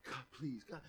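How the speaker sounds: hushed, pleading murmur to God; soft pleading prayer. Retold or imitated. Believer quietly begging God for a loved one, pleading softly. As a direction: plead quietly, close to defeated. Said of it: "we automatically revert back to".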